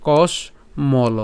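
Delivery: কসমল stretched out very slowly; slow pronunciation. Shown as a demonstration. Teacher demonstrating কসমল to learners, very slow. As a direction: draw কসমল out, sound by sound. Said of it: "কস পর্যন্ত উচ্চারণ করলাম".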